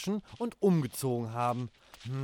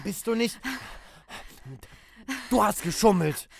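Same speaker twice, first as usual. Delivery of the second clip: quarreling; 5 semitones higher, 3 dB louder than usual. Acted. Children quarrel loudly.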